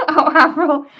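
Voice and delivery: high and loud, laughing the name. Real, not acted. Crafter fondly laughing about her husband, amused.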